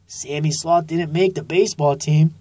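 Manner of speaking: usual speaking voice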